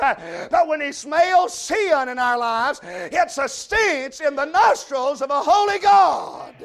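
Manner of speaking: loud, rhythmic revival sermon; full-force preaching. Real, not acted. Preacher telling the congregation that sin stinks to God, full-force preaching. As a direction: drive each phrase out with pulpit force.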